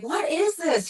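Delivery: incredulous question. Retold or imitated.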